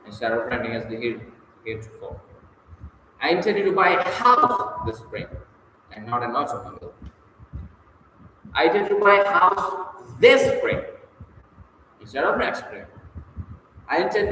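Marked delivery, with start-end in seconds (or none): 3.2-5.3 HOUSE punched high and strong; stress on HOUSE. Shown as a demonstration. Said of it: "putting the emphasis on different words". 8.5-10.8 THIS punched high and loud; single out the word "this"; stress on THIS. Shown as a demonstration. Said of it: "putting the emphasis on different words".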